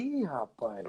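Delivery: very soft, low, wary aside; uneasy mutter. Retold or imitated. Telling how he spotted his roommate staring, low uneasy mutter. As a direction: mutter it under your breath, uneasily. Startled on seeing the watching roommate.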